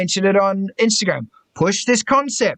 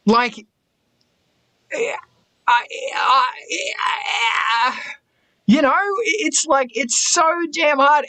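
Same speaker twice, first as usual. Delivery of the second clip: exasperated; 5 semitones higher, slower than usual. Real, not acted. Frustrated rant about many roles.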